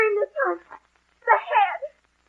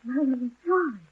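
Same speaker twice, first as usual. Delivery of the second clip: lower, softer imitation of a whispering voice; hushed, low echo of the dream voice; retold whisper; 5 semitones lower, 8 dB quieter than usual. Niece tells uncle of the dream voice, retold whisper. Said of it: "A voice that whispers".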